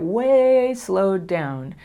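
very slow, drawn out; showing how to slow down. Shown as a demonstration. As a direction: stretch every word. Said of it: "slow down the interaction".

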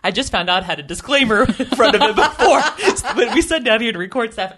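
tickled and amused; amused chuckling while telling it; laughing. Real, not acted. Laughing about the podcast disclaimer.

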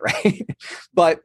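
ordinary talk

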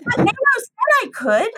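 rising, indignant insistence; insistent repeat. Retold or imitated.

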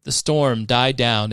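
usual speaking voice

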